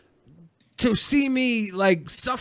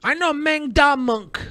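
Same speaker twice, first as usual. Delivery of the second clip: high, slow caricature; imitated boastful stranger; 6 semitones higher than usual. Riffing as the stranger name-dropping, mock boast. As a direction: draw it out proudly as the imitated stranger.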